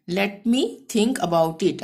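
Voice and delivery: each English word said very slowly and clearly; slow dictation. Shown as a demonstration. Teacher dictating the English sentence, very slow. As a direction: spell it out slowly for learners. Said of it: "अंग्रेजी में कैसे बोलेंगे?".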